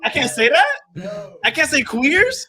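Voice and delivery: high, slow disbelief; incredulous. Real, not acted. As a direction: stretch it out, shocked. Incredulous protest.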